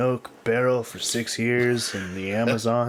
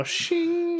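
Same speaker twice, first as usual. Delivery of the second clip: the more you know chime. Retold or imitated. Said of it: "the more you know".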